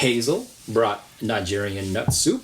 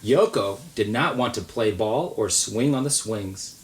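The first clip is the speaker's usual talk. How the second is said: ordinary delivery, nothing marked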